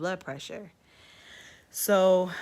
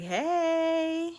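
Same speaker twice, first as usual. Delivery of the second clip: much higher cheery hey; bright hello; 12 semitones higher, 5 dB louder than usual. Retold or imitated. Patient greeting the doctor, bright high hello. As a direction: chirp it up high.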